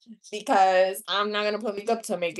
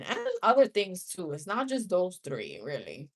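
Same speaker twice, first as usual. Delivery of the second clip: ordinary talk, nothing marked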